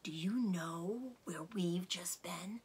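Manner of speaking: slow, secretive whisper; dark whisper. Acted. Mister Fox leans close to Badger, whispering darkly. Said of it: "whispered darkly".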